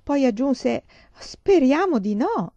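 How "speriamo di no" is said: slow, high, drawn-out dismay; horrified mother, voice swooping high. A narrator's reading. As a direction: stretch it out, aghast at the thought. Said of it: "esclamò mamma orripilata".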